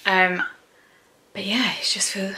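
usual speaking voice

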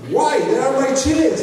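loud, high mock indignation; offended. Man protests to French officials over confiscated chiles, offended. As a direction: protest in outraged disbelief, imitating him. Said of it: "he was very offended".

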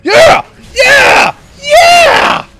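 very high, loud victory screams; triumphant yelling. Real, not acted. Gamer celebrating a kill, all-out triumphant yells.

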